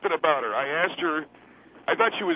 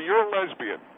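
high mocking voice. Quoting himself to hostess.

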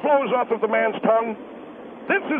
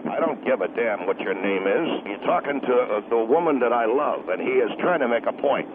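low, gruff angry husband; 13 semitones lower than usual. Acted. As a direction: growl it like a protective husband. Husband threatens caller.

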